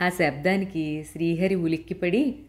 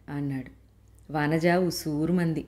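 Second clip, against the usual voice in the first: usual speaking voice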